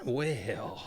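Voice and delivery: soft breathy whisper; whispered tease. Acted. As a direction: hush it like a secret. Teasing the special story.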